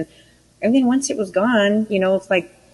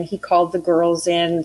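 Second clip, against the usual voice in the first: ordinary talk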